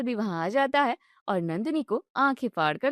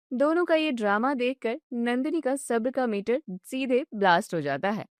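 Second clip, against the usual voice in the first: usual speaking voice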